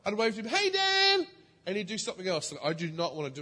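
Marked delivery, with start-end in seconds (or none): 0.5-1.3 cheery call. Retold or imitated. Calling out cheerily to neighbour.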